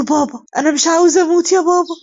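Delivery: high, desperate acted plea to a father; breaking down. A narrator's reading. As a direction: plead like a frightened son falling apart. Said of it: "وسيهان بينهار وبيقول له". (منهار وبيترجى بصوت عالي الطبقة)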